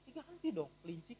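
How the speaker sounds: soft, hushed inner chiding; a quiet conscience nagging; inner voice scolding. Retold or imitated. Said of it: "itu terasa di hati".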